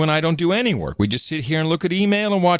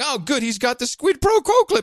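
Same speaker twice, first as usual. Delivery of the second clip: mock excitement; 11 semitones higher than usual. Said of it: "I'm like".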